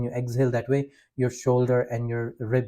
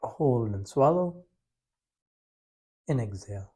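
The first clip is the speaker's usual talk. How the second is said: guided breathing; 3 semitones lower, 7 dB quieter than usual. Acted. Leads a breathing practice.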